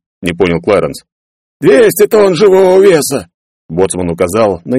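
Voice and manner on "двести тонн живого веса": high, loud panicked yell; alarmed shout. Acted. Boatswain points at the huge monster, alarmed shouting. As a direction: shout it over the chaos.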